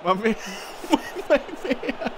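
very high, squeaky laughing praise; laughing delight. Real, not acted. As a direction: crack up admiring a friend's move.

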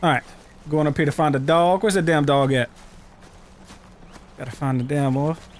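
normal talking voice

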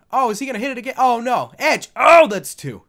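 loud, high play-by-play shouts; excited match call. Pretend. Gamer calling a second German suplex, loud excited shouting. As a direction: build to a shouted near-fall call. Calls near fall like announcer.

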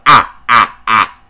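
mocking imitation. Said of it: "the dude, he be like".

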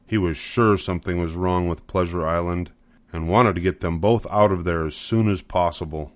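ordinary delivery, nothing marked

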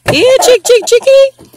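high sing-song chick call; high, loud call to the hens; calling chickens. Real, not acted.

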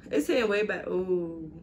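speaking between hookah puffs; talking around hookah hose; mouthpiece at lips. Real, not acted. Seen 1: Speaker holds hose mouthpiece at or touching lips while speaking.

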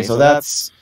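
ordinary talk, nothing marked

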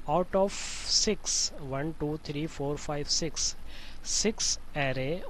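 usual speaking voice

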